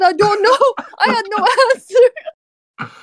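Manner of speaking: high-pitched, breaking into real laughter; laughing bafflement. Real, not acted. Officer asked why she was so calm, laughing. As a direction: admit it helplessly through laughs.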